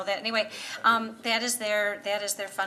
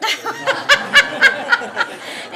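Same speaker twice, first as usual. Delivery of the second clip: laughing; 15 dB louder than usual. Real, not acted. Laughing at cold-room joke.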